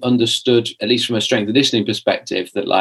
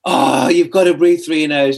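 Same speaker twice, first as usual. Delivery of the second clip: eager enthusiast; 5 semitones higher than usual. Retold or imitated. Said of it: "saying to my wife's, um, mum".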